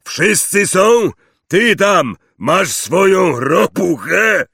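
high, slow, loud hailing; loud calling. A narrator's reading. Checking everyone is present at the oak gate, calling loudly. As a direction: call out a check over the crowd.

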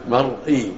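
مرئي said mar-'ee, R without a vowel; silent R in مرئي. Shown as a demonstration. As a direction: close the R in مرئي, then the glottal stop. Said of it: "بينهما راء ساكنة".

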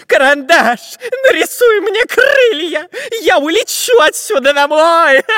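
high, loud, tearful pleading; panicked whining. A narrator's reading. Spy begging Pencil for wings, wailing in panic. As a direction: whine and wail to be rescued. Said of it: "заметался по ветке шпион Дырка".

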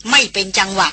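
usual speaking voice

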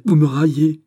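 high, loud protest; indignant cry. A narrator's reading. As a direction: cry out as if mocked. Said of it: "s'écria-t-elle". (cri de protestation, aigu et fort)

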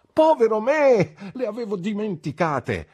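very high, loud, comic outcry; sudden dismay. A narrator's reading. Traddles remembers he forgot the girls, comic dismay. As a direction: cry out at remembering something forgotten.

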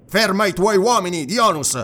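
commanding, high and forceful; urgent command. A narrator's reading. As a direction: call off the men firmly. Orders the attack halted.

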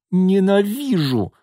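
high, drawn-out cry of hate; acted anguished yell. A narrator's reading. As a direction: scream it out in tears, slowly stretched. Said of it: "выкрикнула она".

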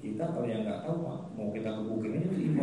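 ordinary talk, nothing marked

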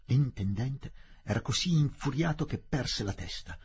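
ordinary talk, nothing marked

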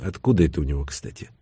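plain reading voice

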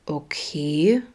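long hummed okay, rising at the end; drawn out. A narrator's reading. Detective reacting to news on the phone, drawn out. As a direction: stretch it out slowly into a rise. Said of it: "summte Vincent langgezogen".

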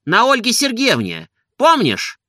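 high-pitched happy announcement; excited. A narrator's reading. Master tells servant the bride's name, high and excited. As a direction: share the news with a lifted voice.

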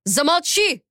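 high, sharp yell to silence her; a man losing his temper, shouting; angry shout. A narrator's reading. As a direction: bark it out in fury. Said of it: "крикнул Боб".

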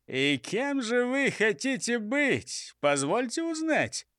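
high, drawn-out sneering question; mocking. A narrator's reading. Chief jeers at robbers' new plans, high mocking tone. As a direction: ask it with exaggerated high politeness. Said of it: "поинтересовался шеф с издёвкой".

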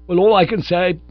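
loud, high indignant outcry; cried out. A narrator's reading. Bingo protesting the borrowed sermon, loud outcry. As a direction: burst out in protest. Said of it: "he cried".

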